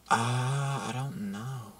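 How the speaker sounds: soft, slow, unsure murmur; hesitant reply. Retold or imitated. Man quoting the girl's doubtful answer, soft and hesitant. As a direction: drag it out quietly, unsure.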